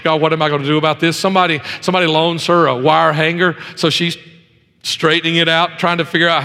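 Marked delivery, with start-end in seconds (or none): none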